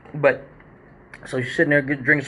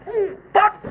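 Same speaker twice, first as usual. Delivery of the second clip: much higher pained ooh; high wince. Retold or imitated. Imitating the audience watching her fall, wincing. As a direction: cringe at the sight.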